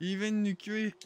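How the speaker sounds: loud, high alarmed cry; alarmed outburst. Real, not acted. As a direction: yell it as you get hit. Getting killed in the game.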